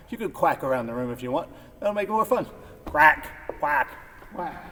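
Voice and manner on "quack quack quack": loud playful duck quacking; duck quacks. Shown as a demonstration. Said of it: "Sound effects are optional. You could go quack".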